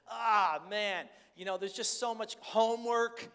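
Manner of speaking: a frazzled father at the end of his rope; exasperated dad. Retold or imitated. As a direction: blow off steam like a worn-out dad. Frazzled dad venting about homework.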